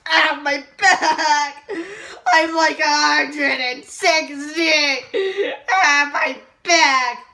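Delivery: put-on slow loud old man complaint; old man groaning.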